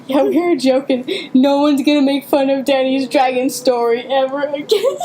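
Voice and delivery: high-pitched, laughing through the words; gleeful, giggly delivery. Real, not acted. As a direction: joke while breaking into real giggles. Giggling co-hosts joking about dragon.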